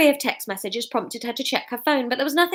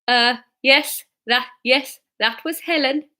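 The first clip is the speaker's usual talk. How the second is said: halting, stammered Geordie; hesitant Geordie-accented reply; 3 semitones higher, slower than usual. Acted. Norman admits who his wife was, halting Geordie.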